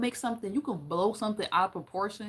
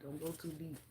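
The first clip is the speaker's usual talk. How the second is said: much softer, trailing off; quiet echo of the advice; soft repeat; 3 semitones lower, 12 dB quieter than usual. Shown as a demonstration. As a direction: say it again near-hushed. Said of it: "Don't go too deep with it".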